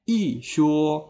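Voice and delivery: "i shuo" slowly, falling "i", level "shuo"; fourth tone before first. Shown as a demonstration. Teacher recapping examples, "i shuo" slowly with falling "i". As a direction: drop "i", then hold "shuo" even. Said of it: "Nah, Lao Shu ulangi".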